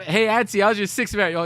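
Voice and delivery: high, excited teasing jab; animated mock heckle; mock taunt. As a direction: throw out a cheeky roast, pitched right up. Replaying a roast of his auntie.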